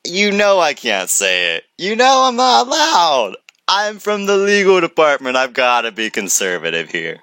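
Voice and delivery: host mocking the legal guy's caution; imitating Ross. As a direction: playfully mimic a cautious lawyer. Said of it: "He's like".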